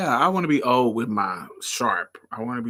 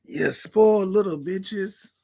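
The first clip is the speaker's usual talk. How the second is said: slow, much higher scolding granny; old lady scolding; 8 semitones higher, slower than usual. Pretend. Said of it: "call y'all privileged and spoiled".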